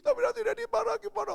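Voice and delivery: high, loud tearful kid imitation; mock childish panic; worried child. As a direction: wail anxiously like a small child. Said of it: "Oh, dia khawatir sepanjang hari".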